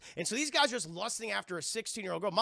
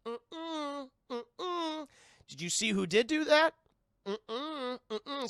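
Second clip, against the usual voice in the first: put-on childlike no; 7 semitones higher, much slower than usual. Carol Anne denying it to her mother, childlike hum.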